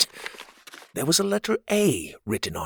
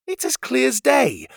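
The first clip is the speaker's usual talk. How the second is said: insisting, pitched up high and a bit louder; defensive; 4 dB louder than usual. Acted. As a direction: push back hard, sure of yourself. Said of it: "Pocket said defensively".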